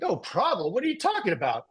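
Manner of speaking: high-pitched retold disbelief; incredulous comeback. Stressed agency owner answering his friend's advice, incredulous. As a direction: echo it back in mock disbelief.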